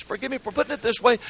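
ordinary delivery

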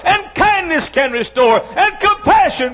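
loud, high, driving pulpit voice; full-force preaching. Acted. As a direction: hammer each line home to the congregation.

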